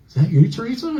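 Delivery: high, rising comic question; hopeful calling out. Retold or imitated. Comic asking a dancer if it's Teresa, high-pitched. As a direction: act out a tentative high-pitched query.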